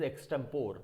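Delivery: extempore ending in "pore"; common mispronunciation. Shown as a demonstration. Teacher quoting what people say, extempore as "eks-tem-pore". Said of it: "this is not extempore".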